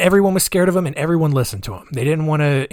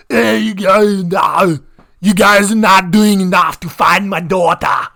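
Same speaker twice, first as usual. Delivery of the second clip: louder, slow hammed-up Austrian tough-guy accent; bad Arnold Schwarzenegger impression; 4 semitones higher, 6 dB louder, slower than usual. Father demanding police find daughter, Schwarzenegger-style Austrian accent. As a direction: boom it out like a movie action hero from Austria. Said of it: "bad Arnold Schwarzenegger impression".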